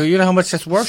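pressing her on the value; indignant question. Retold or imitated. Retold outrage at sale.

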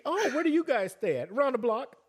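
high, sweet mock neighbor voice; mock polite neighbor. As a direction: play an innocent trick-or-treater. Acted neighbor dialogue.

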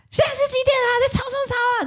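冲着楼上大声质问; shouted complaint. Retold or imitated. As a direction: yell up at the noisy campers, fed up. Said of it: "他们就直接对着上面就说".

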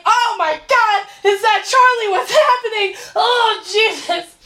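hammed-up panicking mother; panicked mom. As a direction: play the horrified mother, high-pitched. Mother panicking over phone.

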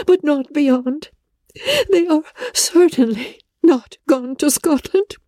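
put-on high, tearful, breaking young woman's voice; pleading through tears, voice cracking; Elizabeth in distress. Elizabeth telling Darcy of Lydia's elopement, tearful.

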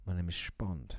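low, hushed Bond impression with slurred s; James Bond. Showing a mate what Bond should say, low Bond impression. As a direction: deliver it deep and quiet, s turning to sh. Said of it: "You say".

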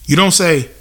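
ordinary talk

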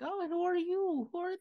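high, surprised who-are-you; surprised old dwarf woman. Acted. Dwarf wife surprised at guests.

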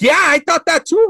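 high, loud retold disbelief; shocked. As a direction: relive the shock, voice jumping way up. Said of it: "I'm, like, shocked".

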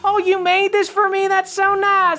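high, squeaky and touched; high-pitched grateful elf. Acted. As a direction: gush high like a moved little elf. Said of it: "they're like".

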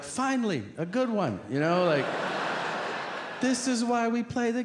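high, eager mock glee; thrilled the big call has come; excited. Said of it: "excited to call".

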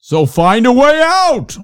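loud, high theatrical cry; hammed-up voiceover. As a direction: call it out slowly in a wild show-host voice. Continues the hammy voiceover impression.

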